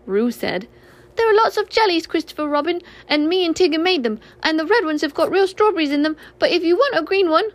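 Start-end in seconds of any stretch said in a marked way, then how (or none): 1.1-7.5 put-on higher, louder eager child; high, eager joey voice; excited little Roo. A narrator's reading. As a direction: rattle it off like an excited little kangaroo.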